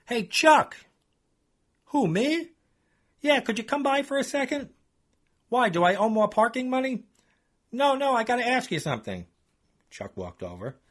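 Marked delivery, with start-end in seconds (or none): 0.1-0.8 loud, high call across the lot; calling out from the booth; yelling out. Acted. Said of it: "Now I'm yelling out the booth window". 1.9-9.3 a higher voice for Chuck; lightly voiced guy; a hint of a put-on Chuck; Chuck's voice. Chuck and narrator exchange.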